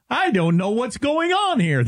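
slow, high cartoon voice; drawn-out, high mocking impression; Frosty the Snowman. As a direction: mimic him as a clueless snowman. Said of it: "That's, that's what he sounds like".

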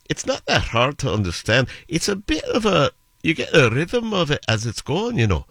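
put-on Scottish lilt with swooping pitch; slow Scottish accent. Shown as a demonstration. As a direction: do a Scot speaking slowly. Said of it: "when you go to Scotland. When they're speaking slow".

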